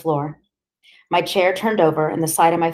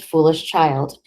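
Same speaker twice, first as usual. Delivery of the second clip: ordinary delivery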